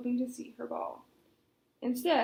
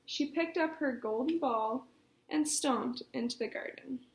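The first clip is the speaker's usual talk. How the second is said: plain reading voice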